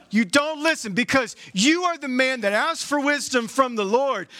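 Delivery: ordinary talk, nothing marked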